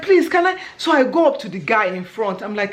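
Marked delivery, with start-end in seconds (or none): 0.0-0.6 frantic please with higher pitch; desperate plea. Retold or imitated. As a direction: beg anxiously. Pleading for help.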